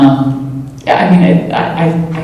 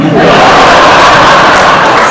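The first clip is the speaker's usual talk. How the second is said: loud, breathy burst of laughter; 6 dB louder than usual. Real, not acted. Actor laughs at own cookie blunder, loud laughter. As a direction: crack up loudly. Embarrassing story punchline.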